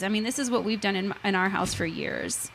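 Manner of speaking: usual speaking voice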